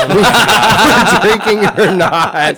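laughing. Real, not acted. Laughing while telling bartender story.

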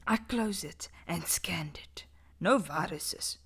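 hushed spooky storytelling; dramatic whisper. Acted. Reading a horror story aloud to friends, whispered. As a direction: whisper it to give chills.

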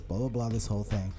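ordinary delivery

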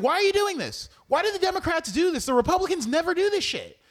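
high, loud frustrated complaint; mock-furious voter yelling at the Democrats; exasperated outburst. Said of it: "And it's like".

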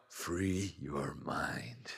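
slow Morpheus whisper. Retold or imitated. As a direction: breathe it out slowly like a mentor.

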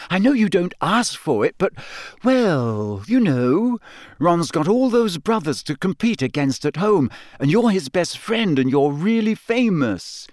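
put-on a clever girl reasoning it out; Hermione voice. A narrator's reading. Hermione explaining.

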